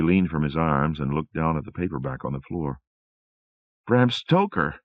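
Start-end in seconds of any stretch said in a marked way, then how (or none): 3.9-4.8 higher, louder exclamation of realisation; cried out. Acted. Rosemary spotting the Dracula paperback, crying out higher and louder. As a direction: exclaim the name as it dawns on her. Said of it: "she cried".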